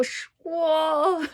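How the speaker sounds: 说 sung out high and loud on a level note; sing-song 说. Shown as a demonstration. Teacher showing how 说 has to go, sing-song. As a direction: belt 说 as one high, level tone. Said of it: "It's like singing".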